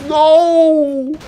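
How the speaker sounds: high loud cry of despair; anguished shout. Real, not acted. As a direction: wail the no. Caster despairing again.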